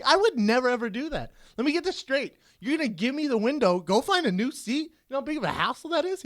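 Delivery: high-pitched comic disbelief; incredulous. Retold or imitated. Comic questioning a seatmate giving up the window, incredulous. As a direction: push it high, astonished by the offer.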